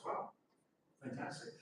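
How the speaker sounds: ordinary delivery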